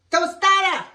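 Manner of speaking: loud, high, proud call; excited shout. Real, not acted. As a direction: announce the dish loudly. Presenting the finished dish.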